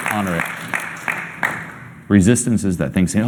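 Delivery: usual speaking voice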